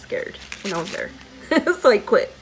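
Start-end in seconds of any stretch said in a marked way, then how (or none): 1.2-1.8 a quick loud burst of laughter; short laugh. Real, not acted. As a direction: laugh at your own story. Laughing about quitting roller derby.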